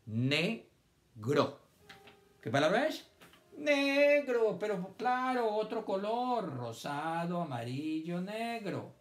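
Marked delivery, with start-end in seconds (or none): 0.1-1.6 NEGRO split into NE, long pause, GRO; syllable by syllable. Shown as a demonstration. Teacher giving syllables for children to guess, split apart. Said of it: "les doy las sílabas". 3.6-6.6 high, loud NEGRO, then easing off; delighted discovery. Pretend. Teacher celebrating children's guess, delighted.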